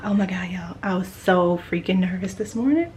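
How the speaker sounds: ordinary talk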